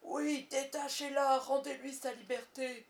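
loud, slow commanding captain's shout; Crochet. A narrator's reading. Peter confirming his false order, commanding shout. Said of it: "imiter la voix de Crochet".

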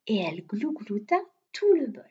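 ordinary delivery, nothing marked

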